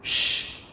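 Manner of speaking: "sh" as a pure voiceless hiss, no vowel. Shown as a demonstration. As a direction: let only air hiss through on "sh". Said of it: "là âm sh". (chỉ thổi hơi "sh", không có nguyên âm)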